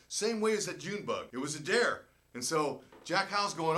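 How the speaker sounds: ordinary delivery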